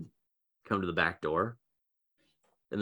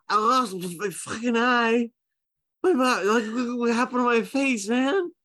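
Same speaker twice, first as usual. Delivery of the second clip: put-on a drunk, higher, teary wail; 9 semitones higher than usual. Injured Ken wails to the guards, high and teary. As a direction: whine about the lost eye, half crying. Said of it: "when Maggie starts crying ... He goes".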